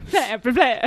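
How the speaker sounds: mock Transylvanian.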